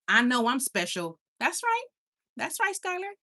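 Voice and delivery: ordinary delivery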